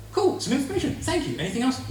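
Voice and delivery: high, loud mock-bright thanks; sarcastic. Real, not acted. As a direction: chirp it sarcastically.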